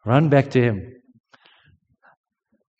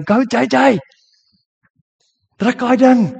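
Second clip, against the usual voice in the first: high, loud sideline cheer; re-enacted high-pitched shout of support; 10 semitones higher, 5 dB louder than usual. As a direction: yell encouragement to the athlete. Cheering an athlete between talk.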